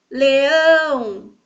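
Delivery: "leão" called loud and slow, ÃO held long; loud long swell on the end of "leão"; calling out. Shown as a demonstration. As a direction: shout "leão" as a call, stretching "ão". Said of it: "Vamos chamar cada uma".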